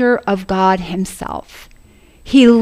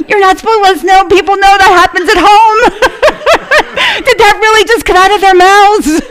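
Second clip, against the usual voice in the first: high, loud parent's protest through laughter; laughing mock embarrassment; 10 semitones higher, 5 dB louder, faster than usual. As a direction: play the mortified parent, cracking up. Acts embarrassed parents while laughing.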